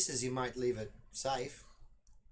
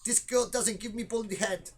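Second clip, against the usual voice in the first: put-on higher Dutch-accented grumble; 8 semitones higher than usual. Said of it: "he speaks English with a Dutch accent".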